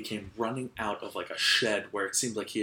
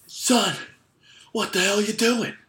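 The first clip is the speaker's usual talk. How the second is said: very loud, high scolding yell; angry mock shout; 12 semitones higher, 9 dB louder than usual. Track worker confronting the boy he stopped, imitated angry shout. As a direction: holler at the kid in imitated outrage.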